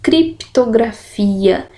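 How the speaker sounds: slow, syllables spaced. Shown as a demonstration. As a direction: sound out criptografia slowly. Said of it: "aqui eu digo crip".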